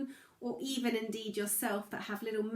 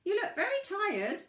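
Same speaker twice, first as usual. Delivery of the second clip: a high, lightly voiced crayfish; 11 semitones higher, 3 dB louder than usual. Crayfish worries over the tired pebble, high voice. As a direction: pitch up for the small concerned crayfish. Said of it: "the crayfish said".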